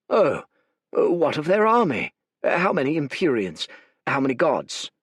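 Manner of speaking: a hint of a nervous leader asking fast; anxious questions. Fate presses the scout about enemy numbers, worried.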